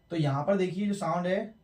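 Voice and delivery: normal talking voice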